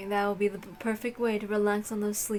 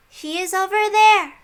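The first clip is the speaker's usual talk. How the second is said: put-on bright servant girl; 9 semitones higher, 8 dB louder than usual. Erica points Lambda out.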